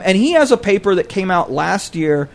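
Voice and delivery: normal talking voice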